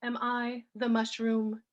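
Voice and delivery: measured poem recital with pauses between phrases; poetry reading. Acted. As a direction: read each line deliberately, letting phrases fall. Reads own poem aloud.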